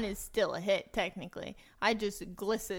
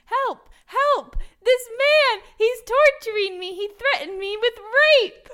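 full-throated shriek, much higher and louder; screaming for help; 14 semitones higher, 14 dB louder than usual. Pretend. Tied-up prisoner shrieking to the sailors for rescue, desperate and loud. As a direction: scream for rescue at the top of your lungs.

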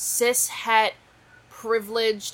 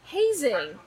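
disgusted; 10 semitones higher, 3 dB louder, slower than usual. Real, not acted. Disgusted at hazing.

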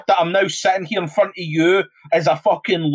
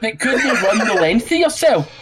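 higher, laughing insult at a pundit; mocking insult; 5 semitones higher than usual. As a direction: jeer at him with a laugh. Mock rant at Piers Morgan.

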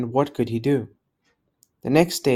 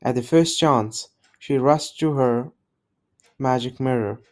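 plain reading voice

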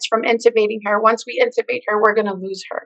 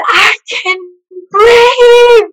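high, loud cry of relief; scream; 11 semitones higher, 6 dB louder, much slower than usual. Retold or imitated. Waking patient screaming to the ward, crying out high and loud. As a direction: shout it out with everything you have. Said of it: "I screamed at the top of my lungs".

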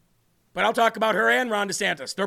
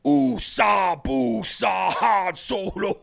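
silly voice bit; much slower than usual. Acted.

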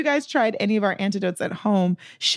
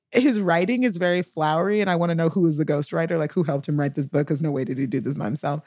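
ordinary talk, nothing marked

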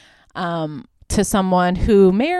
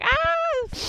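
high-pitched mock scream; imitated scream. Retelling the movie victim's reaction, high mock scream. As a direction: squeal out a thin "ah" as her scream. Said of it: "And she's like".

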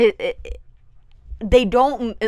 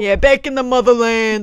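loud, higher mock Italian-American boast; proud Jersey Italian; 5 semitones higher, 9 dB louder than usual. Co-host adding to the caricature, loud Jersey Italian accent. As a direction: join in the hammed-up Jersey Italian bragging. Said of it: "they have this complex about being Italian".

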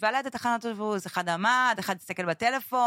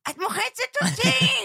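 put-on much higher, louder, slow yelp of a squashed kid; son yelling; 14 semitones higher, 4 dB louder, slower than usual. Mother quoting her son under her, high slow child's yell. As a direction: cry out like a child being crushed. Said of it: "ואז הוא מתחיל לצעוק".

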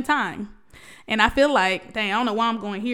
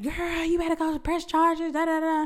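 higher, mocking voice of friends giving advice; imitating nagging friends; 7 semitones higher than usual. Mocking people who told her to press charges, higher. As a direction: mimic the busybodies. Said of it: "a lot of people be like".

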